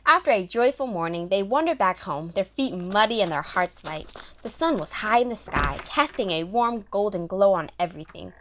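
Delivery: normal talking voice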